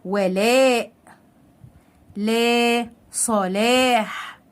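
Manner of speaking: slowly stretched aa in "ولاء", "لا", "صلاح"; long alif madd. Shown as a demonstration. Teacher repeating the alif words, drawn-out "ولاء", "لا", "صلاح". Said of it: "مد بالالف".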